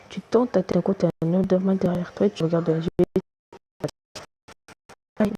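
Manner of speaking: ordinary talk, nothing marked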